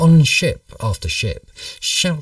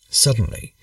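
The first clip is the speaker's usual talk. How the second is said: ordinary delivery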